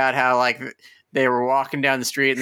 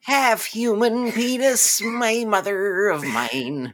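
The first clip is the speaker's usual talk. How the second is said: put-on slow, grand old-fashioned son addressing his mother; old-timey son; 4 semitones higher, much slower than usual. Minotaur boy formally asking his mother, in a slow, grand voice. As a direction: make it stately and antique, drawing it out. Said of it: "since I was a little minotaur boy".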